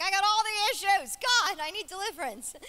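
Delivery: loud, high hammed-up wail to heaven; desperate cry. Woman overrun by hormones begging God, mock desperate cry. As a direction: cry out for rescue, comically overwrought.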